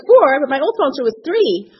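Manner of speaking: high, loud startled protest; mock shock. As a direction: bristle at the new rule. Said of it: "I'm like".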